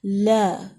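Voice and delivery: لا with the vowel between a and e; لا neither fully open nor narrow; correct mid vowel. Shown as a demonstration. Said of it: "لا بھی نہیں ہوگا".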